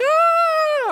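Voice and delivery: very high, loud, drawn-out shriek; long comic wail of terror; mock terrified scream. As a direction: scream like a panicked coaster rider. Mimicking own terror on the coaster.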